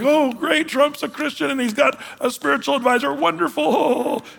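lampoon the crowd's naive joy; mock gushing believers. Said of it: "everybody's like".

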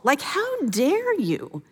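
slow, drawn-out mock indignation; indignant outrage. Preacher voicing the enraged Sanballat, slow indignant disbelief. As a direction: stretch out how dare you with disbelief. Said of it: "He's mad that they would".

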